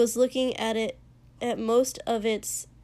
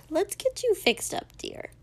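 put-on much higher, tender old lady voice; sweet old woman; 9 semitones higher than usual. A narrator's reading. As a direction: coax him like a kindly granny. Old woman tends to Shoto.

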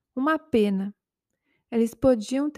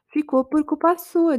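raised voice; 5 semitones higher, 6 dB louder than usual. A narrator's reading. Girlfriend blaming boyfriend, voice rising louder and higher. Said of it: "aumentando o tom da voz".

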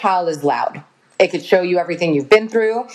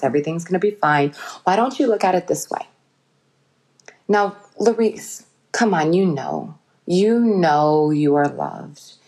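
calm him like a loving guide; a gentle reassuring inner voice; tender loving voice; 3 semitones lower than usual. Pretend. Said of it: "That very tender, loving voice".

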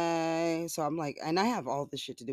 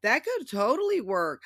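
very high sarcastic hum, then louder; sarcastic agreement; 13 semitones higher, 4 dB louder, slower than usual. Retold or imitated. Mocking the tabloids to viewers with a very high sarcastic hum. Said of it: "I'm like".

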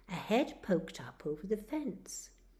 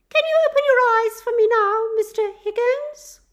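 much higher, louder, fast nurse voice; nurse coaxing; 19 dB louder than usual. Acted. Nurse asks Charlie to open his eyes, high and coaxing. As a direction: coax the patient awake.

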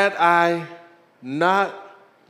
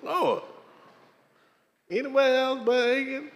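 high pleading whine; 10 semitones higher than usual. Retold or imitated. Pastor begging God, high whiny plea. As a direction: beg the Lord in a high voice. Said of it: "Why, Jesus".

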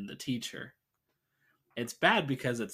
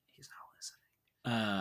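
unvoiced aside whisper; 5 dB quieter than usual. Real, not acted. Host whispering an aside about his absent friend. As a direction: breathe it as a quick secret aside.

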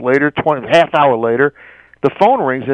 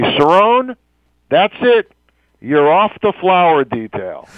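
higher-pitched mock angry call from behind; retold bonkers boss; 5 semitones higher, slower than usual. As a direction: imitate an irate boss calling out a dismissal. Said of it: "he goes absolutely bonkers".

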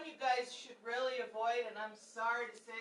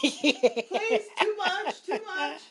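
laughing protest; 8 semitones higher, 11 dB louder, much slower than usual. Real, not acted. Cohost protesting through laughter.

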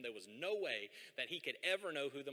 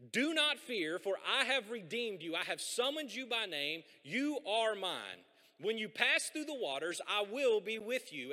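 loud, raised preaching read of the verse; scripture reading; 5 semitones higher, 6 dB louder, slower than usual. Acted. Pastor proclaiming God's promise to the congregation, loud preaching read. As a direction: proclaim the promise slowly and boldly.

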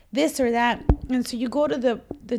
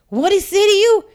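high, loud call across the counter; yelling over to a coworker, much higher and louder; nosy shout; 9 semitones higher, 10 dB louder than usual. Retold or imitated. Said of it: "next door D M V person's like".

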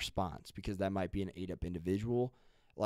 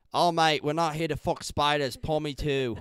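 loud, higher-pitched Aussie mate; hammed-up Australian impression, pitched up; Australian accent; 6 semitones higher, 10 dB louder than usual. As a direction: boom it out in a broad Australian accent. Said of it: "That's what Australian people will say".

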